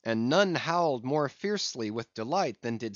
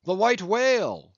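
higher pitched cry of sighting, louder on the second; excited cry; 8 semitones higher, 5 dB louder than usual. Acted. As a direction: call out the whale sighting, rising to a shout. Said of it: "was the cry from the captain".

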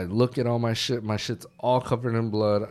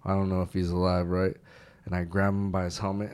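ordinary talk, nothing marked

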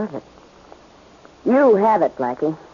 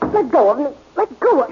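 higher on the second, straining against his grip; struggling protest; 5 semitones higher, faster than usual. Acted. Miss Gaines wrestling free of Blackie's grip, struggling protest. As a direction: fight him off while protesting. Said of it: "You might as well stop struggling".

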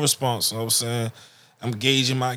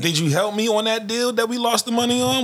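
indignant mock dad; 9 semitones higher than usual. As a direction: press the son as the dad. Mock dad questioning son.

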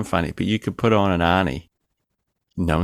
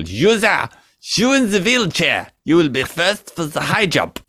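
higher, hammed-up German officer voice; mock German accent, lightly raised; 6 semitones higher than usual. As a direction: bark it like a cartoon German officer. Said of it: "doing a German accent".

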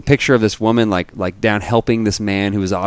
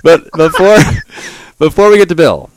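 high, loud, still cracking up; laughing; 8 semitones higher, 8 dB louder than usual. Real, not acted. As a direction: talk through the tail end of a laugh. Still laughing from previous line.

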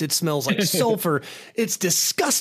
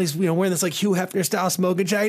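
usual speaking voice

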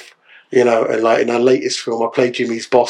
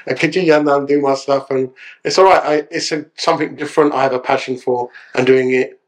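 ordinary talk

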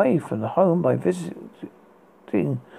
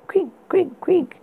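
high breathy creak sounds; whispered creaking; 11 semitones higher than usual. Acted. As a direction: whisper the bed's creak eerily. Creaking bed sound imitated.

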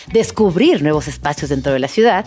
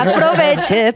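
street vendor hawking tickets; hyped fairground barker; 6 semitones higher than usual. Pretend. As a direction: hawk it like a market seller. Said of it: "precio compa ahorita".

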